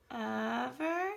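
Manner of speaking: ordinary delivery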